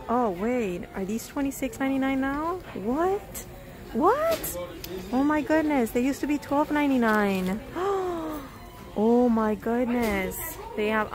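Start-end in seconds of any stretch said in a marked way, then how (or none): none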